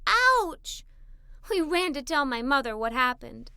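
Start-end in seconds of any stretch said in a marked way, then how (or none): 0.1-0.8 sharp high ouch; pained yelp. Acted. Girl yelping as a bee stings her.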